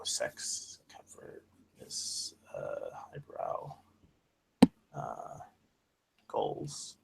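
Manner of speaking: whispered under the breath; whispering. Real, not acted. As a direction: mutter the words while reading. Reading the sheet under breath.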